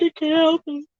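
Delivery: whiny self. Retold or imitated. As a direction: whine it up high like a kid. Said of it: "I'm like".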